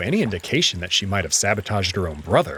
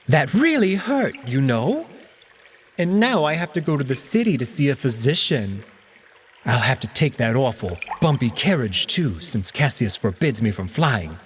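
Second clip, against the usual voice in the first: higher, petulant cat voice; Alex, complaining cat; 3 semitones higher than usual. Acted. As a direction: whine about the ribs like a sulky cat. Alex complaining about his injury.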